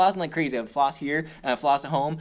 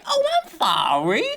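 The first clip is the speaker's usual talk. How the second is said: high, slow mock apology; hapless nurse impression; 9 semitones higher, slower than usual. Bungling nurse apologizing to her patient, high mock voice. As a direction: squeal a drawn-out, comically insincere sorry. Acting Lee as a terrifying nurse.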